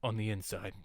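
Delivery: low, soft deadpan Drax; Drax impression. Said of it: "and he's like".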